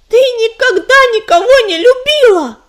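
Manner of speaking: high, loud furious shout. A narrator's reading. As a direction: hurl the accusation. Furious accusation at mentor.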